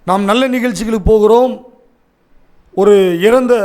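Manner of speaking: ordinary talk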